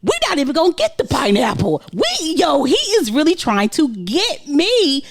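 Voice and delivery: high-pitched, comically outraged thought; indignant inner voice. Retold or imitated. Husband retelling his panicked thoughts to wife, mock indignant. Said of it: "I'm saying".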